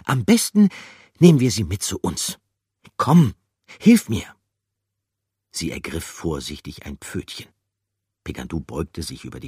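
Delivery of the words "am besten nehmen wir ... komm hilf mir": high, loud urging; character voice. Acted. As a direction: urge a friend to help.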